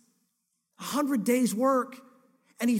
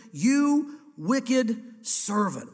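each word dragged out very slowly and heavily; slow stern rebuke; 3 dB louder, much slower than usual. Acted. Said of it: "Wow, this is hard language".

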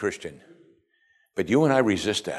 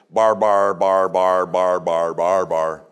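loud droning monotone babble, sinking in pitch; imitating barbarian talk; 2 semitones lower, 3 dB louder, much slower than usual. Greeks' view of foreigners' talk, mock babble. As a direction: mimic foreign speech as meaningless syllables. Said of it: "they talk like this".

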